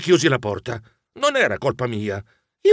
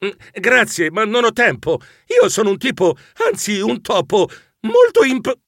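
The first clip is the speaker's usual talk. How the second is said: mild, higher-pitched grumbled refusal; a touch of fussy muttering; borbottio; 6 semitones higher than usual. A narrator's reading. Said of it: "Io borbottai". (borbottio appena accennato)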